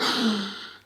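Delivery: sharp shocked intake of breath; collective gasp. Retold or imitated. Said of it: "Everyone went".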